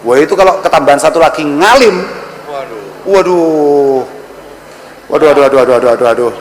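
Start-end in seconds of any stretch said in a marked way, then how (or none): 2.5-6.3 put-on groaning dismay, repeated; mock dismay. Preacher teasing an unmarried guest, mock dismay.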